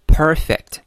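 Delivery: "perfect" with a loud, long first syllable; first-syllable stress. Shown as a demonstration. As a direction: lean hard on the "per" of "perfect". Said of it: "第一个音节 重读音节". (重读并拉长 perfect 第一音节)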